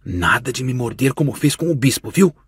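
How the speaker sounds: hushed, secretive warning to her brother; soft sisterly whisper, playful scolding. A narrator's reading. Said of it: "ela sussurrou".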